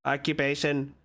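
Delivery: low, even robotic monotone. Acted. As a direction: speak like a machine. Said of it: "what a strange robotic voice you have".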